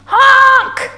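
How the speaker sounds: high, loud horn cry; scared honk. A narrator's reading. Stuck dump truck calling for help, scared honk. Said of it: "and he sounded scared".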